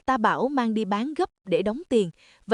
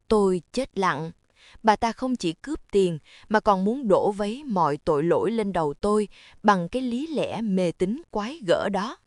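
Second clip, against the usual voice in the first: plain reading voice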